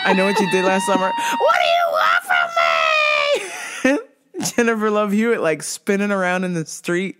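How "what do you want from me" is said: very high, hammed-up movie scream; movie-style desperate scream. Imitating Jennifer Love Hewitt spinning in the street, high mock scream. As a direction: wail it like a horror heroine.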